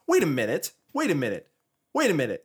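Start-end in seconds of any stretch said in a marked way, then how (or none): none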